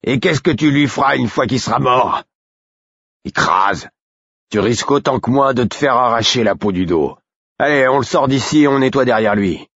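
high, pushy guard voice; jeer, then order him to back off; mocking. A narrator's reading. Second guard berating his comrade, mocking. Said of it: "d'un ton moqueur".

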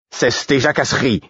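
curt. A narrator's reading. Murfess silencing the fool's chatter, curt. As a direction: cut the chatter off curtly. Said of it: "dit sèchement Murfess".

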